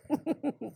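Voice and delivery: a small embarrassed chuckle. Real, not acted. Reader laughing at her own slip, short chuckle. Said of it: "reader laughs at her slip".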